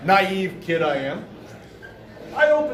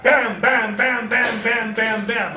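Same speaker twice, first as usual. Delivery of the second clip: imitated door banging; slower than usual. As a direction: hammer out the knocks evenly.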